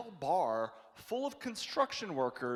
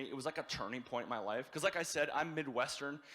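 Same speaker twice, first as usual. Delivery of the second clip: ordinary talk, nothing marked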